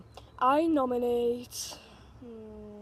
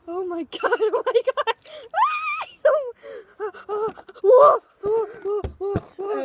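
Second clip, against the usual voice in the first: shrieking from ice water; 9 semitones higher, 11 dB louder than usual. Real, not acted. Bucket of ice water poured.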